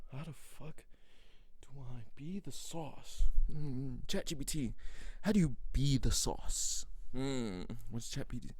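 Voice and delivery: slow, puzzled mock muttering; confused overthinker. Imitating people confused about the sauce, slow and puzzled. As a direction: play a lost, dithering overthinker. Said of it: "be like".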